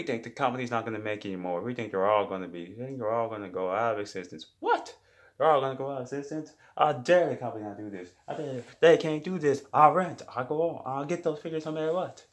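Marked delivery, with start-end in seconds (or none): 4.6-6.5 much higher, fast, squeaked what; shocked disbelief. Acted. Fan hears figures discontinued. 6.8-12.2 higher, louder hammed-up vow; mock fury. Fan vows to get figures.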